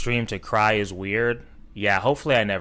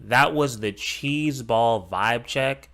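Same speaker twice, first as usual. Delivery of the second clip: slow mock quote; slower than usual. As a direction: drag "cheese ball vibe check" out slowly, half-mocking. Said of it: "if you say unironically".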